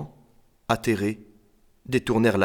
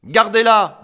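high, loud refusal; defiant child; 10 semitones higher, 8 dB louder, slower than usual. A narrator's reading. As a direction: shout it back at the adults. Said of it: "dit Émile". (refus crié, aigu)

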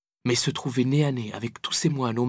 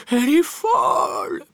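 loud, high, drawn-out whimper; whining wail; 13 semitones higher, 6 dB louder, slower than usual. A narrator's reading. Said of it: "pleurnichait-il".

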